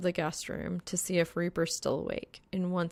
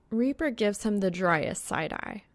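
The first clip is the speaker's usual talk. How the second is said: plain reading voice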